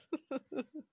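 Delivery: quiet, high little chuckle; soft giggle. Acted. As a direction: giggle softly mid-thought. Amused pause mid-sentence.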